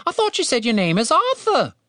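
high-pitched British bird voice; confused talking bird. A narrator's reading. Confused bird questions Chaz.

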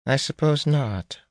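slow, high, muffled woman's reply; lightly stifled, high-pitched voice. A narrator's reading. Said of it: "said in a muffled voice".

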